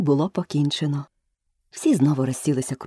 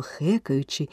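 plain reading voice

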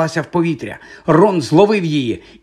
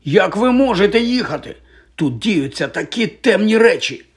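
higher, urgent appeal; exclaiming; 7 semitones higher than usual. A narrator's reading. Boy protesting the professor's departure, urgent exclamation. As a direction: cry out in disbelief. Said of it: "вигукнув Гаррі".

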